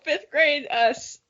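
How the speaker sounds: normal talking voice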